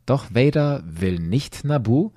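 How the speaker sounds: plain reading voice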